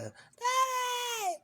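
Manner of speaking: put-on very high-pitched little girl screaming out; child's scream. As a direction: shriek it like a small daughter calling her dad from the crowd. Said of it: "Mila Ba screaming through the whole end set".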